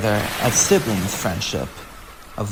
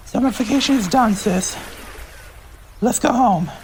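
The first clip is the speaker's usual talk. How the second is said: high, quick cheerful call; cheerful sister; 9 semitones higher than usual. Acted. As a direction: wrap up the holiday happily. Arcee announces going home.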